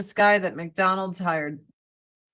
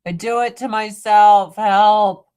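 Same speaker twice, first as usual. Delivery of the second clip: exasperated cry for help; 8 dB louder than usual. Retold or imitated. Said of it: "I'm like".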